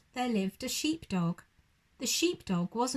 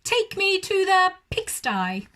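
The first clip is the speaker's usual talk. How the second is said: high, loud command; bossy urgent order; loud demand; 9 semitones higher, 7 dB louder than usual. Acted. Owl ordering cow.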